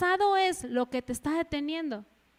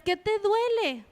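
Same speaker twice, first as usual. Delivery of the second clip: higher, slower, insistent question; exasperated mother; 7 semitones higher, slower than usual. Acted. Mother to crying child, acted desperation. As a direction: demand it like a mom at wits' end.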